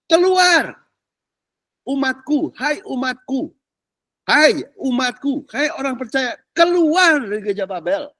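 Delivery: high, ringing call to come out; commanding divine call; voice of God calling. Acted. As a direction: proclaim it like God summoning his people. Preacher voicing God's call out.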